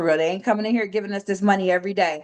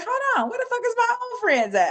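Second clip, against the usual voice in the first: much higher, startled disbelief; sudden alarm. Retold or imitated. Trader replaying realizing old friends vanished, alarmed. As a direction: catch yourself mid-panic. Retold panic, friends gone.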